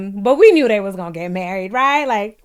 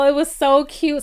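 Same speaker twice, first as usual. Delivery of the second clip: ordinary talk, nothing marked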